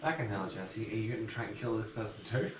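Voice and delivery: ordinary talk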